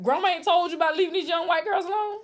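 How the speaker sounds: high, loud and fast scold; incredulous scolding. Acted. Scolding Shannon, raised voice.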